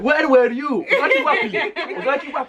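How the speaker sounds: high, laughing mock interrogation; imitating angry parent. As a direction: demand it high, half laughing. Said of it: "you'd be like".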